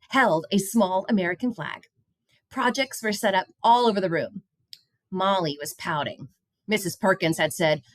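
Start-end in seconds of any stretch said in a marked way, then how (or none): none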